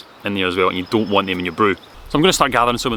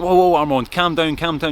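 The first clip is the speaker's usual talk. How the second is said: higher-pitched, placating plea; urgent calming; 7 semitones higher than usual. Retold or imitated. Narrator calming his hot-blooded French friend, placating. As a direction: talk down a worked-up friend.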